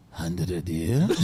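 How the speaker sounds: a low-voiced Gaza local answering the tourist; grim local man. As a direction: play the local, deadpan and dark. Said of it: "And he'll go".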